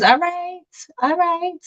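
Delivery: light up at the idea; excited approval. Real, not acted. Reacting to a viewer's suggestion, delighted and high.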